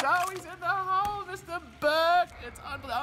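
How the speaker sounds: ecstatic call. Pretend. Announcer ecstatic at another hole in one.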